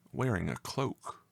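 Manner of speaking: plain reading voice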